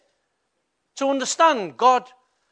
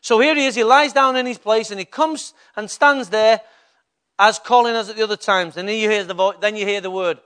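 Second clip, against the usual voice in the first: usual speaking voice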